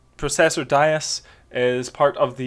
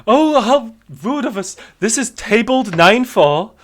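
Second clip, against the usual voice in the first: pompous clerk voice; 6 semitones higher, 5 dB louder than usual. Acted.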